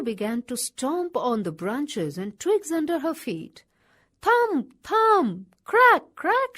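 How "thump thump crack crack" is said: higher, louder punched sound words; stomping beats. A narrator's reading. Kangaroo stomps the branches, high loud punches. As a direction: hit each word like a stomp.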